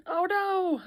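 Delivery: sudden high "oh no"; alarmed. Real, not acted. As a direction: blurt it out in alarm. Notices flaw in mold.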